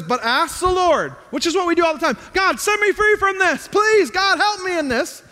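impassioned sermon delivery; preaching. Acted. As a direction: preach it out to the congregation. Preaching at full force.

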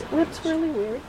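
ordinary talk

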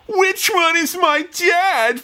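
high, drawn-out mock plea; hammed-up, high and slow; confused daughter. Podcaster voicing the daughter choosing between two dads, hammed-up confusion. Voices daughter in movie standoff.

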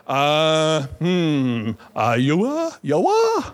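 hammed-up confused reading, leaping up in pitch; baffled sounding-out. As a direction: grope at an unpronounceable word. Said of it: "We don't know how to pronounce that".

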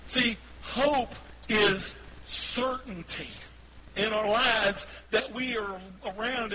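loud, high, slow proclamation; emphatic preaching. Real, not acted. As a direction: drive each phrase home to the congregation. Preaches the point at full force.

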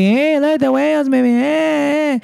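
very high, slow, sing-song mocking taunt; bully taunt. Bullies taunting the kid in the pool, high sing-song mockery. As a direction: tease the fat kid in a high drawl.

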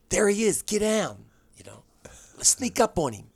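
a light camp voice creeping up on someone; swishy character. Retold or imitated. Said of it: "I did sort of a swishy character".

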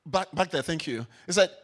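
usual speaking voice

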